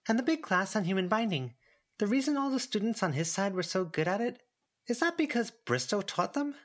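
lightly voiced girl Verona. A narrator's reading. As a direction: ask it like a wide-eyed kid.

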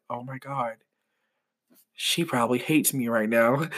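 softly horrified; hushed dread. Retold or imitated. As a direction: hush it, dreading. Inner dread after note.

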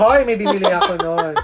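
loud protest through giggles; laughing mock protest. Mock protest amid shared laughter. (natatawang pagtanggi, malakas)

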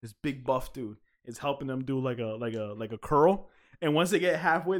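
ordinary talk, nothing marked

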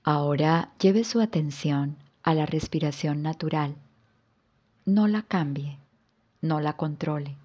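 calm yoga nidra guidance with long pauses; guided relaxation. Acted. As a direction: guide the listener into rest, phrase by unhurried phrase. (relajación guiada, con calma y pausas largas)

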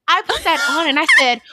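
ordinary delivery

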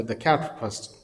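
ordinary talk

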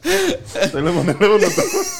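laughing through the words; giggling, high and breathless. Real, not acted.

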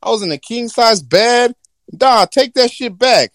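hammed-up whiny genie; indignant genie. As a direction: protest being pushed into the human world. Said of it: "He gonna be like".

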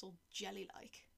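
ordinary delivery

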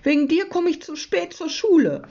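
high-pitched accusing complaint; cross. A narrator's reading. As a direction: blame someone crossly. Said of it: "sagt Jonathan böse".

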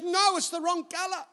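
put-on high Bulgarian-accented contradiction; mock Bulgarian-accented wife. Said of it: "in her little Bulgarian accent".

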